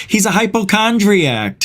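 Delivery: high-pitched dismissive parent; imitated parents. Parents explaining away his broken arm, imitated high dismissive voice. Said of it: "And my parents said".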